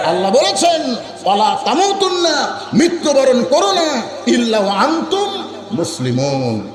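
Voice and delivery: high, ringing sermon cries with stretched words; full-force preaching. Real, not acted. Preacher urging the crowd on the Quran, full-force preaching. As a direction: cry it out to rouse the crowd.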